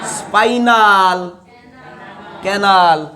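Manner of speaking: drawn-out syllables with a gap between the words; slow, prolonged call for repetition; leading class repetition. Shown as a demonstration. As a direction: stretch each word slowly for students to chant along. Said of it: "बोलो रे बच्चों".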